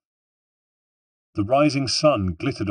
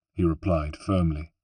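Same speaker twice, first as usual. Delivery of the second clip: usual speaking voice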